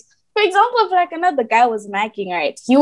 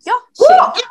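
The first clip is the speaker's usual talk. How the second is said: much higher and louder, an exclaimed "Yo, shit"; shocked outburst; 12 semitones higher, 4 dB louder than usual. Retold or imitated. Retelling her shock at his insult, high and loud. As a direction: blurt it out high in disbelief. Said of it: "Guys, me, I was like".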